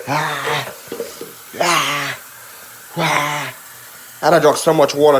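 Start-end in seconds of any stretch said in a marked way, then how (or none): none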